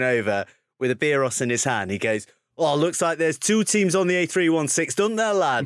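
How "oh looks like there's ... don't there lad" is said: higher, gloating imitation of the coach's accent; smug raised mock accent of the coach; Paul Gustard's accent. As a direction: crow about the win in the coach's accent. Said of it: "you've nailed his accent as well".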